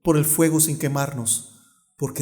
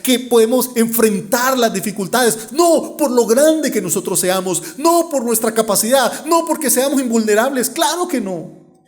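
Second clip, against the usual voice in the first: higher, emphatic, building preacher's delivery; fervent preaching; 8 semitones higher, 4 dB louder than usual. Real, not acted. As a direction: hammer each "no" to the congregation. Preaches with fervour. (predicación ferviente y enfática)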